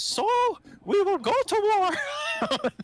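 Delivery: very high, loud, slow mock war cry; a stoic pirate declares war, hammed up; stern pirate declaration. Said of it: "he says".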